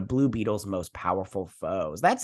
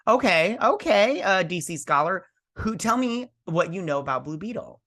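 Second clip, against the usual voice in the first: mock challenge; 7 semitones higher than usual. Retelling own challenge to friend.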